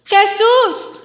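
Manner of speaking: high, loud repeated yell; insistent full-voiced call. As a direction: keep crying out, refusing to be silenced. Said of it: "dice la Biblia que gritaba más fuerte".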